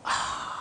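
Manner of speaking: soft, breathy "ahh" of relief; long quiet exhale of satisfaction; refreshed sigh. Acted. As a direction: sigh as if cold water hit a dry mouth. Relief of cold water drink.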